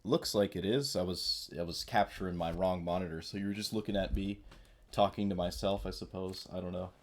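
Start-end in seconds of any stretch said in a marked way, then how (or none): none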